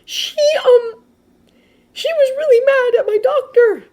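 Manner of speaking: very high, loud, faltering voice; embarrassed Midoriya. Acted. Midoriya admitting the nurse was mad, embarrassed. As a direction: stumble into it in a high voice.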